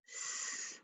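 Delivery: "S." as a voiceless hiss, no vowel; just the sound of "S.", unvoiced sss. Shown as a demonstration. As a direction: breathe out the sound of "S." instead of the letter name. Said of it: "the final sound is S".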